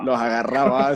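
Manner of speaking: ordinary talk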